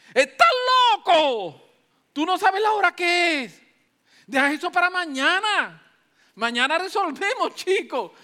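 loud, high, annoyed rebuke; angry scolding. Acted. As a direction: tell him off for waking you. Woken friend scolds angrily.

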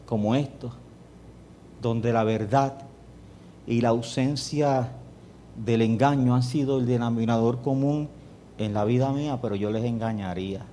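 ordinary delivery

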